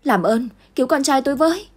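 high, loud desperate plea; mother screaming for someone to save her son; screaming for help. Acted. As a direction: scream it at the burning house. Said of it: "Lượm vừa la vừa hét".

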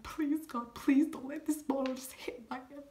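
high, hushed desperate plea; whispered scared prayer to God; frightened prayer. Retold or imitated. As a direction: beg God under your breath, terrified. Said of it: "I was so scared".

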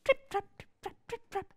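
high, rhythmic trip trap; light hoofbeat chant; trip trap hoof chant. A narrator's reading. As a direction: tap out little hooves on the bridge. Hooves crossing the bridge.

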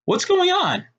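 high-pitched startled question. A narrator's reading. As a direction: ask it in sudden bewilderment, pitch leaping up. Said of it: "startled by the experience".

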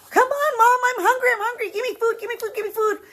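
put-on a child nagging mom for food; impatient hungry kid. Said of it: "And you're like".

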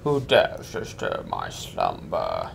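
deep, very slow monster voice; drake. Pretend. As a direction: rumble it like a waking beast. Voicing the boss waking up.

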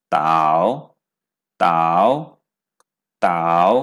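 তাও dipping low and loud, Chinese third tone; Mandarin third tone. Shown as a demonstration. Teacher drills the dipping tone on তাও. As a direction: drop তাও deep then lift it. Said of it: "আবার third tone".

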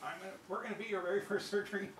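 high, loud mock disbelief; incredulous squeak of a worried patient, played for laughs; alarmed patient. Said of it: "You're like".

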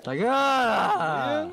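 slow, loud, stretched shout; drawn-out yell. Acted. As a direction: bellow it out slowly. Mock yelled insult. (hurlement traînant)

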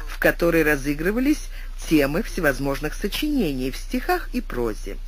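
ordinary delivery

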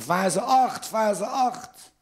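high put-on woman's call; mock wife calling. Comic voicing his wife crying out in bed, high call.

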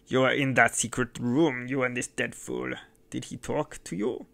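a lightly voiced mad innkeeper savouring revenge; menacing madman. A narrator's reading. Mad host gloats over the dead bandit, menacing. Host suspects the secret room.

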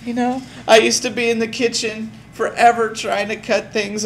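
high, gushing infomercial customer voice; over-the-top grateful customer, half laughing; gushing testimonial customer. Retold or imitated. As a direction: ham up a tearful satisfied buyer. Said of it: "They're like".

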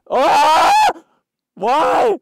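very high, loud wail of defeat; anguished yell. Real, not acted. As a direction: shout it in dismay. Cries out at losing the run.

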